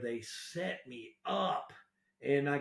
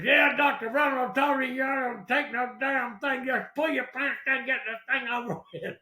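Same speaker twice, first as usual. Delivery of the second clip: loud, high mock military bluster; hammed-up general ordering a patient about; old retired general; 9 semitones higher, 6 dB louder than usual. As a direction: imitate a hardcore army doctor shouting orders. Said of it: "like an old retired general".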